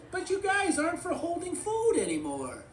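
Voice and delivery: high, loud protesting toy voice; Forky. A narrator's reading. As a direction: pitch it way up and object. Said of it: "Forky goes".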